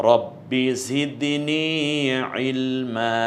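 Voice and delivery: slow recited রব্বি জিদনি ইলমা ending on an open long a; Quranic recitation. Shown as a demonstration. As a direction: chant ইলমা with a held final a, no n. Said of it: "ইলমান না বলে আমরা ইলমা বলবো".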